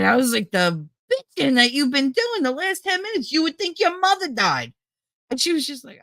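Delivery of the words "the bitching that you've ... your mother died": high, exasperated scolding; irritated, incredulous rant; retold irritation. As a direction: scold a wailer you're sick of, pitched up. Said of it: "I got so irritated".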